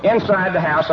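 ordinary talk, nothing marked

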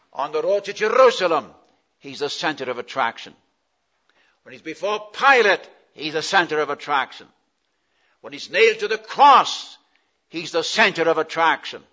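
loud, high-pitched openings, each answered by a refrain; emphatic preaching. Acted. Preacher driving home Jesus as center of attraction, emphatic preaching. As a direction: preach it out in ringing parallel phrases.

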